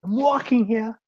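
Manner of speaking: high-pitched New York protest; New York accent. Shown as a demonstration. To a cab driver who almost bumps you, New York accent. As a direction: call it out as an indignant New Yorker. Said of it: "a fucking cab driver almost bumps you".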